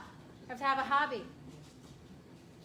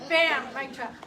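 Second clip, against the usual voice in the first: louder, triumphant joking shout; mock mic drop; 10 dB louder, slower than usual.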